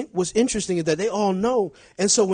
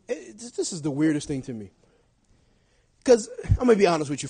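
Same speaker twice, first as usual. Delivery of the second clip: ordinary delivery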